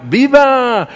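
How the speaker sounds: high, loud festive yell; crowd's cheering shout. Acted. The crowd answering the man on the balcony, shouted cheer. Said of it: "Y la gente grita".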